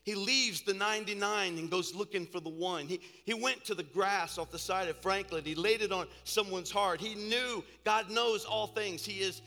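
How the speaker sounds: impassioned sermon, steadily gaining volume; building preaching. Real, not acted. Preacher telling the church how God answered a mother's prayer, building fervour. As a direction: preach it in driving phrases, building toward the end.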